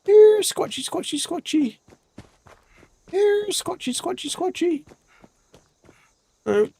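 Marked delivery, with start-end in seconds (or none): 0.0-4.8 very high sing-song call, falling at each end; calling a creature. Real, not acted. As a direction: coax it out like calling a pet, high and drawn. Calling for a sheep squatch creature.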